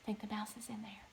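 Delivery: fast hushed whisper; quick suspenseful whisper. Real, not acted. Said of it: "whispered suspense aside".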